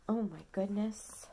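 usual speaking voice

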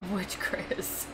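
hushed breathy whisper. Shown as a demonstration. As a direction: whisper it back softly. Echoing the same question.

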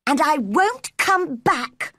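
slow, high angry parting shot; shouted reply. Acted. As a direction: fling it back in a high voice. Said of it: "Mary shouted in reply".

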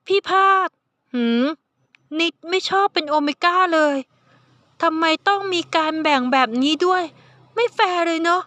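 put-on high, pouty young voice; sulky youngster. Nid complains about being omega.

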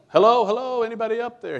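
loud, high call for help; desperate high yell upward. Retold or imitated. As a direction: shout up the cliff for anyone. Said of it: "He started to yell".

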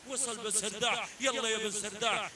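ordinary talk

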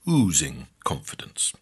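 plain reading voice

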